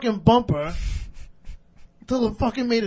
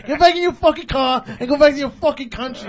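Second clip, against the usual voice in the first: loud, raised re-enacted tirade; retold angry dismissal; 3 semitones higher, 8 dB louder than usual. Driver sends cabbie away, retold angry tirade. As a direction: act out sending him packing.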